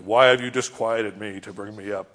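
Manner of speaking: low, loud voice from the grave; Samuel's spirit. Acted. Impersonated dead prophet speaking to Saul, low mock-solemn voice. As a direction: intone it deep and loud, as a mock apparition. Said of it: "this impersonation of Samuel begins speaking to Saul".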